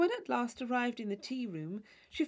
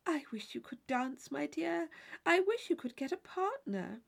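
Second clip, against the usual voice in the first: placid Mrs Allen; 6 semitones higher than usual. Acted. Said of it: "saying very placidly".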